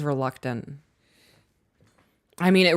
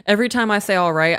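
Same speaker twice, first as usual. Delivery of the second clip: ordinary talk, nothing marked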